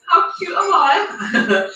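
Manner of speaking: high and slow with a laugh. Real, not acted. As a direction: giggle at your own cuteness. Showing off mini milk pint.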